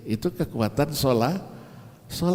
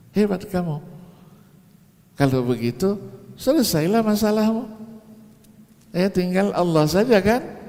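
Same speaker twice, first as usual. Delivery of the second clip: impressed doctor; 7 semitones higher than usual. Retold or imitated. Doctor praising the patient.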